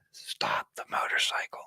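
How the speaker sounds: hushed, voiceless plea; very soft whispered instruction. Real, not acted. Hushed plea after rant.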